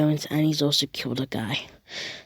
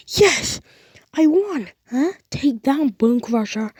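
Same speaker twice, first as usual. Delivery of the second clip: triumphant cheer; 9 semitones higher than usual. Acted. Deku cheering after beating Bone Crusher, very high. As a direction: crow over the win.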